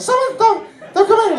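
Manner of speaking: scared, squeaky falsetto plea; Mickey Mouse. Acted. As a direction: shriek very high in comic panic to keep someone out. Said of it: "I sound like Mickey Mouse".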